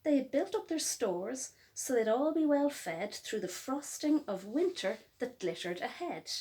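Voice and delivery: plain reading voice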